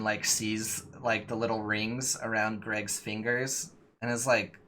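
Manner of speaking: ordinary delivery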